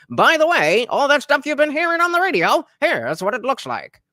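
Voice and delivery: put-on high, loud old-timey announcer; old newsreel announcer. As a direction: boom it out like a newsreel.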